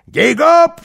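high, loud whoop; joyful cry. A narrator's reading. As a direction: shout it with glee, leaping upward. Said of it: "вскричал он".

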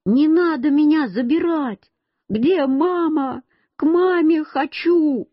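put-on high-pitched, pleading little-boy cry; child crying out. A narrator's reading. Lost boy begs the soldier for his mother, crying out. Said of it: "Я закричал".

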